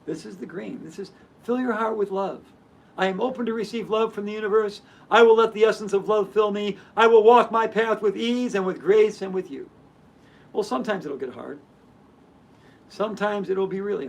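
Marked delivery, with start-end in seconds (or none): 1.4-9.7 steady, earnest affirmation, swelling louder at the end; affirmation reading. Acted. Reading the heart chakra saying aloud to viewers, earnest affirmation. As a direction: read it like a heartfelt mantra.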